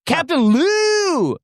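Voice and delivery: slow, high, drawn-out call; spooky call. Acted. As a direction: call the ghost's name eerily. Ghost name called out.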